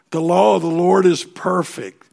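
loud, high declaration with weight on law; booming pulpit proclamation; proclaiming loudly. Acted. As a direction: ring it out to the back row. Preacher proclaims verse loudly.

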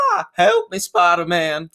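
high, squealing cry for help; hammed-up old lady shriek for help; mock old lady cry. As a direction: ham up a helpless old woman's plea. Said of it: "He's like".

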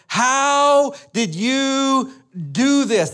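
high, very slow incredulous yell; imitating a dad losing it, drawn-out and high; exasperated outburst. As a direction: wail each word in mock outrage. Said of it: "he's literally jumping around like".

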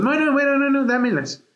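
high-pitched imitation of a hasty change of mind; urgent backtracking. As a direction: mimic her suddenly wanting them, voice jumping high. Retracta su rechazo de las flores. (retractándose de golpe, imitación en voz aguda)

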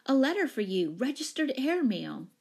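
high, eager announcement; calling out. A narrator's reading. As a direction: call the news across the room. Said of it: "she called".

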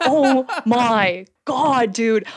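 laughing slowly through the words; breaking up at her own memory; slow laughing gasp of disbelief. Real, not acted.

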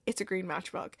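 imitating a hushed, low realization; stunned quiet quote. Rebecca looks down at the matchbook, imitated quiet realization.

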